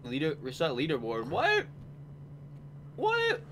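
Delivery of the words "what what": disbelieving cry; shocked what. Real, not acted. Shocked by leaderboard reset.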